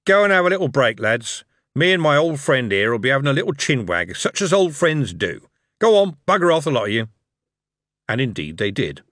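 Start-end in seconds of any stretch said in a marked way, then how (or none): 0.1-5.5 louder, higher boss voice with a matey swagger; genial crime boss. A narrator's reading. Harry dismisses his bodyguards. 5.8-6.6 loud, higher bark to clear the room; shooing shout. Acted. Boss shooing bodyguards away. 6.6-7.1 louder, higher boss voice with a matey swagger; genial crime boss. A narrator's reading. Harry dismisses his bodyguards.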